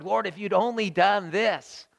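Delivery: anguished questioning that climbs in pitch; pleading complaint. Acted. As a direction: cry out in rising protest to God. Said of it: "How many times have we said that to the Lord".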